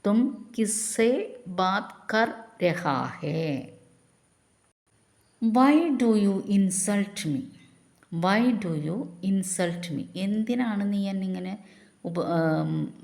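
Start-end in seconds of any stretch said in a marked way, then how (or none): 0.1-3.7 slow, evenly spaced words; slow model sentence. Shown as a demonstration. Teacher repeating the Hindi question slowly for learners. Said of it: "അപ്പം നമ്മൾ എന്ത് പറയും?". 5.4-10.0 very slow, word by word; slow dictation. Shown as a demonstration. Teacher reading the English prompt slowly to the class. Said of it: "slow English model phrase".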